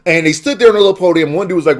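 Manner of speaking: usual speaking voice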